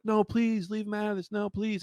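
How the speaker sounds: mock pleading. Retold mother begging for her kid, high mock plea.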